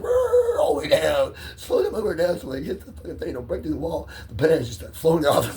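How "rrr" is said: growling engine noise. Retold or imitated. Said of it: "He's like".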